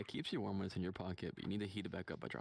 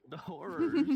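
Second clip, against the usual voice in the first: high slow hammy wail; mock dramatic; 12 dB louder, much slower than usual. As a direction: ham up the horror.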